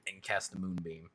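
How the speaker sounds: ordinary delivery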